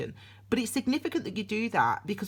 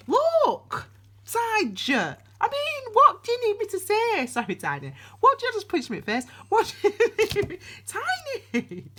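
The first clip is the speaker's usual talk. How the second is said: startled outburst; 13 semitones higher, 5 dB louder than usual. Real, not acted. Dog punches her mid-reading.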